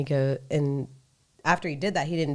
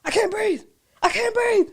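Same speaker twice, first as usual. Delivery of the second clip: high, whiny mock protest; drunk guy complaining. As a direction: wail it like an overdramatic drunk. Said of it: "and then he goes".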